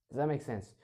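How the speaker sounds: normal talking voice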